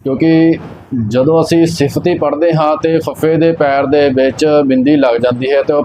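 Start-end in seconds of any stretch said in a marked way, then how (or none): none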